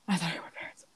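breathy whisper aside; under-the-breath whisper. Real, not acted.

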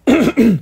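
sharp throat clear after choking; choking cough. Real, not acted. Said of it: "perdón, me atraganté".

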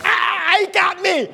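mock cry of alarm. Preacher hamming being grabbed, high yelp. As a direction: play the victim, caught and crying out.

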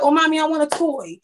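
put-on a touch of a kid begging at the store; little boy asking. Son asking mommy for a toy, lightly voiced whiny child. Voicing her young son.

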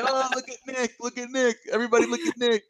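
high-pitched mocking imitation of a drunk; drunk friend's voice. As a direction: imitate a drunk friend calling everyone over. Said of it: "then I heard him say".

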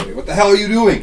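high, loud outraged shout; alarmed shout. Acted. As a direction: catch the thieves in the act, yelling. GM voices woken family shouting.

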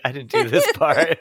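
laughing through it; giggling panic. Retold or imitated.

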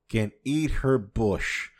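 ordinary delivery

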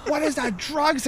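high-pitched mock interrogation of a kid; dad pouncing on a suspicion, voice jumping up; retold suspicious demand. Retold dad confronting hidden pocket.

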